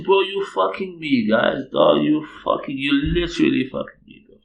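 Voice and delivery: loud, high-pitched protest; hammed-up incredulous rant; acted outraged disbelief. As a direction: plead and accuse at full volume. Acted outraged question to colleagues.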